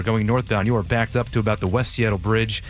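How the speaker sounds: ordinary talk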